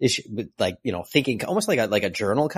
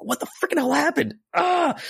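much higher, exasperated cry; frustrated. Real, not acted. Fan exasperated by the story's mystery, crying out high. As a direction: throw up your hands at the plot.

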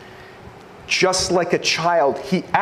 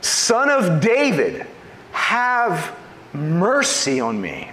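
raised, slow, pleading cry for mercy; a beggar crying louder over the crowd; 4 semitones higher, slower than usual. Retold or imitated. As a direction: call out louder, stretching it. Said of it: "Louder now".

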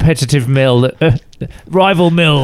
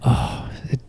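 whispering; 3 semitones lower, 7 dB quieter than usual. Acted. Results reveal, tense hush.

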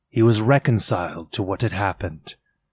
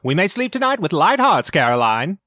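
higher, quicker voiced husband; 8 semitones higher, 4 dB louder, faster than usual. A narrator's reading. Husband reassures wife Caroline, lightly voiced relief. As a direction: read the husband's relief a bit brighter and quicker.

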